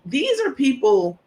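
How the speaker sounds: usual speaking voice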